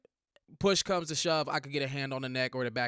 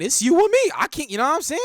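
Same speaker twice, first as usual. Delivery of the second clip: loud, high-pitched burst; emphatic outburst; 12 semitones higher, 9 dB louder than usual. Real, not acted. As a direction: raise the voice sharply, suddenly worked up. Hyped-up him-or-me declaration.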